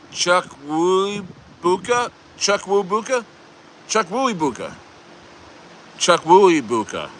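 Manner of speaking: tentative, careful tries at "Chuck Woobuka", questioning at first; trying the pronunciation. Real, not acted. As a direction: sound out "Chuck Woobuka" slowly, syllable by syllable. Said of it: "I'm trying to pronounce it right".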